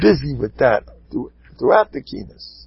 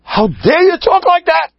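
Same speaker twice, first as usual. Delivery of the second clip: explosive high-pitched outraged yell; acted yelling; 4 dB louder than usual. Rav exploding at the gabbai, yelling in staged fury. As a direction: blow up at him, voice far up. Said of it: "he yells at the Gabbai".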